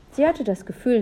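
plain reading voice

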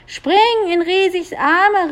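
high, urging shout; calling out. A narrator's reading. Julia urges the prince to jump, calling up. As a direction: urge it out slowly in a high call. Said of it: "rief Julia dem Prinzen zu".

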